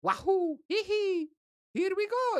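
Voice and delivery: very high cartoon plumber cheer; Mario voice. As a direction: squeal it like the game hero jumping. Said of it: "if he ruins".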